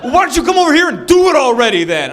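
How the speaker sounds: high, loud, aggressive challenge; hammed-up confrontational shout. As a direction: yell it across like a dare. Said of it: "Now you're raising your voice to me".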